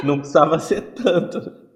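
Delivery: amused disbelief breaking into laughter; real laughter at how bad it got; laughing. Real, not acted. Laughs while speaking.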